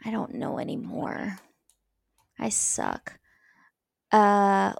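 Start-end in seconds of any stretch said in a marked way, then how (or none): 0.0-3.2 low self-deprecating mumble; muttered. Real, not acted. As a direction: mumble it to yourself.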